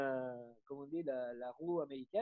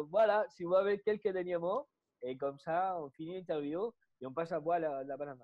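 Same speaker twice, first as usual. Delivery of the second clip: usual speaking voice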